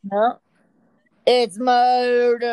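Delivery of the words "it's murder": dramatic announcer. Pretend. Playful radio bit continues.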